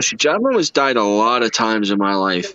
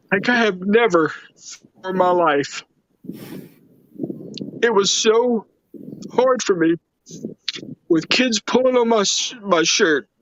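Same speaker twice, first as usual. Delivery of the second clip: emotional, voice breaking; 8 semitones higher than usual. Real, not acted. Recalling helpless moment on plane.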